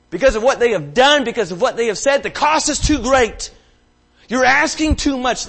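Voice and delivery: loud, high impassioned sermon climax; preaching at full force. Acted. Pastor pressing the church on forgiveness, impassioned loud preaching. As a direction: build the congregation up, pleading and loud.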